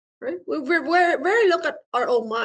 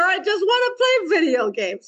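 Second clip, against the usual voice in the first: put-on higher, pouting kid voice; whiny toddler; 6 semitones higher than usual. Teacher voicing the inner child, hammed-up whine. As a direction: beg like a two-year-old wanting games. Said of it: "your two-year-old self".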